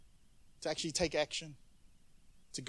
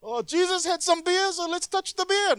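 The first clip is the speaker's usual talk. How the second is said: much higher, goofy voice of a confused churchgoer; mock clueless listener; 13 semitones higher, 6 dB louder than usual. Preacher mocks listeners who mistake bier for beer, goofy high voice. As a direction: play the dumb guy who thinks it's beer. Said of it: "Some of y'all are like".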